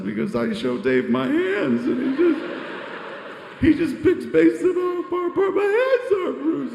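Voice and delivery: very high, loud blubbering excuse; hammed-up wail, squealing through the words; mock crying. Director pleads with the star about lenses, mock crying. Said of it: "Stop crying".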